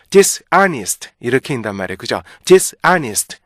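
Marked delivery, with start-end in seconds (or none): 0.1-1.1 "dishonest" split as DIS, then AH-nist; "dishonest" with a hard break after dis, both halves stressed; Korean learner's split. Retold or imitated. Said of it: "우리나라 사람들 이거 읽는 거". 2.4-3.4 "dishonest" as two punched pieces, DIS and AH-nist; wrong split reading. Retold or imitated. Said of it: "이렇게 읽으면 안 돼요".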